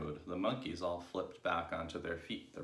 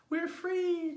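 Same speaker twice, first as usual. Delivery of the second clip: very high, loud squeal of joy; excited little ones' cry; 7 dB louder than usual. A narrator's reading. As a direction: cry out like thrilled youngsters. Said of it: "cried the two little ones".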